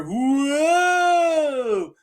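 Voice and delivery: long, high tripping "whoa"; Damon. Acted. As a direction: wail it out as if on an acid trip. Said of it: "It's my impression of Damon".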